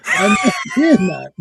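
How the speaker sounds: high, protesting mock outrage; mock indignation. Call-center worker retelling denial of Canadian accent, high mock indignation. Retold quarrel over saying "aboot.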